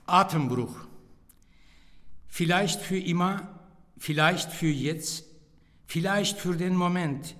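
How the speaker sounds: spaced-out lines with long pauses, slowing at the close; poem recitation. Real, not acted. Poet reading his poem Atembruch aloud, measured recitation. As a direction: recite it line by line, letting each phrase hang.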